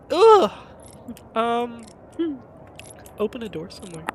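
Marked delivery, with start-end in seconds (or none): 0.1-0.5 post-sneeze groan. Real, not acted. Groan right after sneeze.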